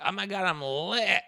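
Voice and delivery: put-on high, drunken party voice; imitating drunk Erika. Retelling Erika drunk on the boat, tipsy imitation. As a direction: slur it like she's tipsy. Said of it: "She's like".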